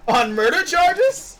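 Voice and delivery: loud, drawn-out disbelief; incredulous. Real, not acted. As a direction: stretch it out in shock. Incredulous outburst at a friend's joke.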